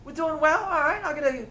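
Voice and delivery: high-pitched mock-Maori accent. Shown as a demonstration. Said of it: "I can do a Maori one".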